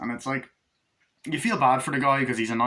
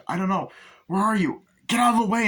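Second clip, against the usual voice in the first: high panicked calling, louder; mock frantic yell; frantic shout; 10 semitones higher, 5 dB louder than usual. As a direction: call out searching in a panic. Pushing through the school crowd.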